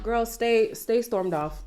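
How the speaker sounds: ordinary talk, nothing marked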